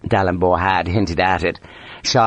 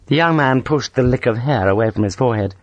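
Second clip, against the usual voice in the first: plain reading voice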